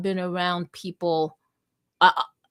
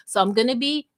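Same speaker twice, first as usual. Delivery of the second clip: ordinary delivery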